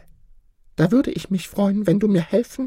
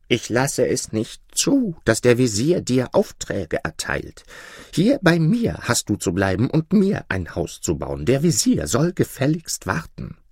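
jealous lion; 8 semitones lower than usual. Acted. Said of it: "sehr neidisch".

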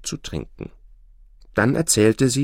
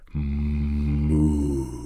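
ox moo; 5 semitones lower than usual. A narrator's reading. Ox lows behind him.

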